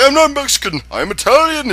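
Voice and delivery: high indignant mock hero voice; mock Mario. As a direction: squeak out the protest. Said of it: "He's like".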